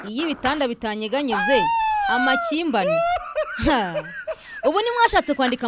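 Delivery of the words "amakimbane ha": laughing at a typo. Real, not acted. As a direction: say it through a laugh. Mocking misspelled word.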